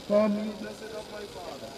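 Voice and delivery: usual speaking voice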